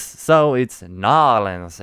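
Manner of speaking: "Nolans" loud and drawn out; Southern-style Nolans. Shown as a demonstration. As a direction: declare "Nolans" slowly, in two long syllables. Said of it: "this Southern accent is gonna be hard to shake".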